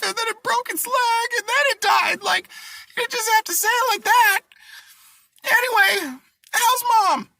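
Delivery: very high mock-hysterical squeal; wife crying out. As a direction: wail it like a hammed-up upset wife. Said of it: "the wife cries out".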